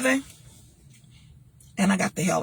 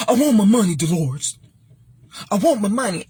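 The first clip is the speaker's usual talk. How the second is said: angry husband; 3 dB louder than usual. Retold or imitated. Husband's angry demand retold.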